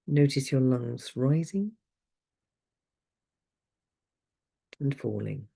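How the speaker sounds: calm, unhurried guiding voice with long pauses; guided meditation. Acted. Leads a breathing meditation.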